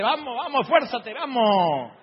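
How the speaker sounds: slow, loud, strained urging. Retold or imitated. Mover at the top urges the one carrying the piano, slow loud urging. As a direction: push him up the stairs with your voice.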